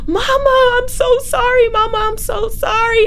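high, loud wailing cry of Mama; reliving a sobbing scream at a funeral; child screaming at a casket; screaming sobs. Retold or imitated. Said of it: "I start screaming uncontrollably".

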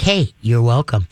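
low, slow, easy dog voice; laid-back dog. Acted. Calm dog replies with a tail wag, laid-back. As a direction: answer low and relaxed. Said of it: "little tail wag like".

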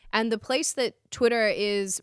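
ordinary talk, nothing marked

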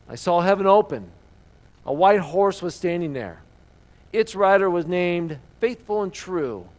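dramatic scripture reading. Acted. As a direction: read it out like a herald's vision. Reads Revelation nineteen aloud.

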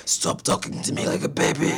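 breathy, rasping dog complaint; the dog protests, gruff and breathy; demon dog voice. Retold or imitated.

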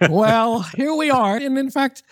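high, bright announcement of a treat; festive announcement. Acted. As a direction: present the holiday bonus. Announcing holiday bonus.

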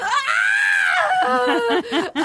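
high wailing sob-laugh; wailing laugh-cry. Retold or imitated. Wailing as the weeping mom.

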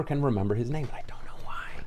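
hushed aside with no voice behind it; whisper. Real, not acted. To co-hosts, admitting he forgets Colin Farrell's name, whispered. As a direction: let it drop to a breathy confession.

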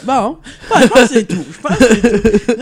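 loud laughing words. Real, not acted. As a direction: talk through a big laugh. Laughing through the sign-off.

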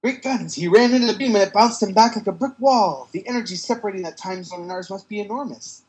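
gee-whiz comic book hero; hammy hero voice. Acted. Comic hero dialogue read.

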